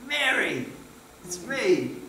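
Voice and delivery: quiet, tender recognition; gentle. Shown as a demonstration. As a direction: say Mary, it's me softly, as if letting her know. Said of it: "I thought it would be".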